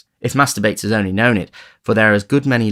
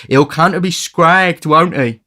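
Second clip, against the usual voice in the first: high, loud slow relish; gleeful tease; 9 semitones higher, 7 dB louder, slower than usual. A narrator's reading. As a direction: relish the gruesome joke. Charlie teasing with hanging.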